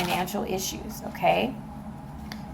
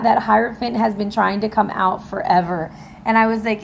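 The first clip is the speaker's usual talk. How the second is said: normal talking voice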